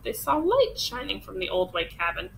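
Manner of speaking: usual speaking voice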